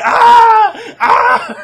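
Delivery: very high, very loud mock scream breaking into laughter; screeching hurt lady, laughing through it. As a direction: ham up the dinner lady's shriek, cracking up. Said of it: "She's like".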